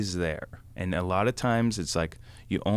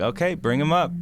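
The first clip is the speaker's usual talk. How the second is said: higher, breezy call down from the porch; lightly voiced mom calling down; 8 semitones higher, 4 dB louder than usual.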